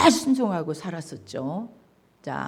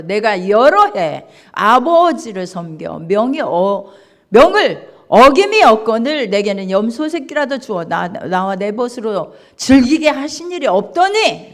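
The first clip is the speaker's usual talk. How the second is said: indignant; 8 dB louder than usual. Acted. Elder son's reply read aloud.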